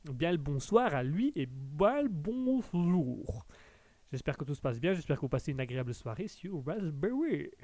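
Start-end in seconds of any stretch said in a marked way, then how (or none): 1.3-3.5 put-on slow, drawn-out English accent. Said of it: "Il faut vraiment que tu arrêtes l'accent anglais". 6.3-7.5 attempted English accent on the station name. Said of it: "Il faut vraiment que tu arrêtes l'accent anglais".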